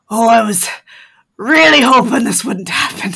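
lightly voiced werewolf confession, a little loud; a touch of embarrassed pleading; Sarsa in character. Werewolf admitting it to companions, mildly pleading. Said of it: "and she's like".

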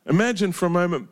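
ordinary talk

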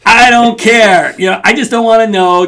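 loud stubborn refusal to care; loud mock defiance; defiant denial.

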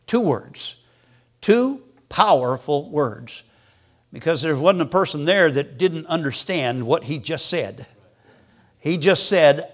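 ordinary talk

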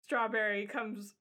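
normal talking voice

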